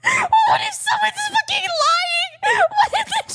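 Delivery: hysterical laughing. Real, not acted. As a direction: squeal it out, cracking up. Hysterical laughter over the quotes read out.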